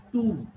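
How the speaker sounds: "two" with a stretched oo; long oo in "two", set against a short one. Shown as a demonstration. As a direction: draw out the vowel in "two". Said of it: "this is long".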